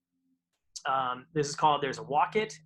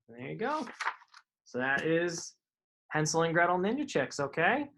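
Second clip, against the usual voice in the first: ordinary delivery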